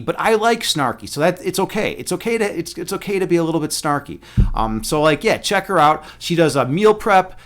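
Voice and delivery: ordinary talk, nothing marked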